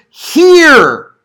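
"Hear" high, loud and drawn out; stress on Hear. Shown as a demonstration. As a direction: punch "Hear" as one long, loud word. Preacher contrasting the translated word.

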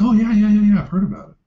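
high, loud put-on teenager voice; mock eager teen. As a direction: play the kid brushing it off, loud and high. Teen patient voiced in story.

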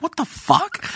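high pitched disbelief; mock shock. Reacting to a man throwing rubbish on the floor, high disbelief. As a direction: squeak it out in disbelief.

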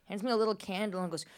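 ordinary delivery

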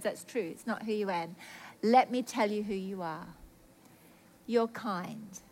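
low, soft voice of God; gentle reassurance. Retold or imitated. God's reassuring reply to repentance.

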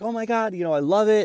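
high excited gushing; gushing fans. Retold or imitated. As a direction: gush like a thrilled fan. Fans gush about podcast.

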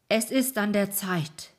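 calling out with a big voice; mighty call. A narrator's reading. Old man proclaims the hour has come, mighty call. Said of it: "rief der Alte mit gewaltiger Stimme".

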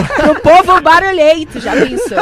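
loud, slow, laughing; laughing joke. Real, not acted. Guest joking with the hosts about her past, laughing loudly. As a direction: toss it out through laughter.

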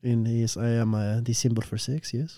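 low, soft mock-Italian patter; Italian accent. Improvising as the beanie character, Italian accent. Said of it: "speaking in an Italian accent".

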